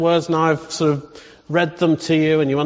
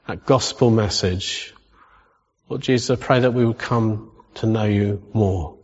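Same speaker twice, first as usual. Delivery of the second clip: low-pitched prayer with pauses between phrases; lowered, measured voice of spoken prayer; praying aloud; 5 semitones lower than usual. Real, not acted. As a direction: pray for the congregation, unhurried. Prays aloud for the congregation.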